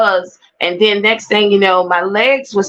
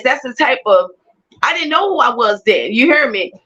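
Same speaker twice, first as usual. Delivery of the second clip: usual speaking voice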